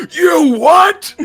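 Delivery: high squeaky yelled "You what?"; SpongeBob fish yell. Retold or imitated. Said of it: "the fish from SpongeBob yelling".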